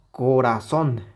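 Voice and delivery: "corazón" with strong final "zón"; acento en la última sílaba. Shown as a demonstration. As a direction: land firmly on "zón" in "corazón". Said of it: "Ya no es lo mismo que". ("corazón" con fuerza en "zón")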